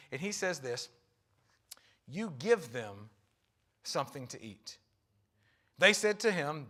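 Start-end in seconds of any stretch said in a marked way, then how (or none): 2.1-4.8 slow, low, quiet and steady; calm command. Retold or imitated. Jesus calmly telling disciples to feed crowd. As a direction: let it land gently, like a quiet challenge.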